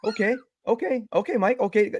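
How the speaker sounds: anxious settling; frantic okays. Retold or imitated. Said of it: "what the fuck is Mike doing".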